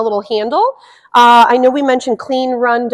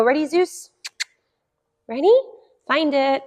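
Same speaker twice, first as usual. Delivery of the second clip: playful cue; 4 semitones higher, 4 dB quieter than usual. Shown as a demonstration. Cueing puppy playfully.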